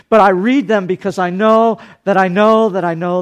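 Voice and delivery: high, loud preaching from the pulpit; full-force preaching. Acted. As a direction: preach it out to the whole church, big and ringing.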